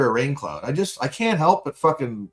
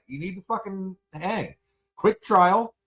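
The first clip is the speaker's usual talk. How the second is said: usual speaking voice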